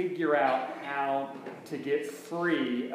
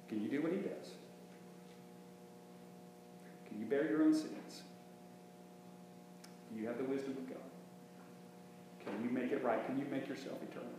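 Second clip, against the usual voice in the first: hushed rhetorical questions; 14 dB quieter than usual. Acted. Preacher drops to hushed questions.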